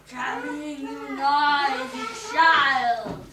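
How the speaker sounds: slow, loud gritty scream; a witch screaming at the child. A narrator's reading. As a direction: drag it out like grinding sand. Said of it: "screamed a voice like gritty sand".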